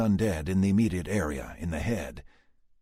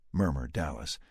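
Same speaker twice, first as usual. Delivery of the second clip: plain reading voice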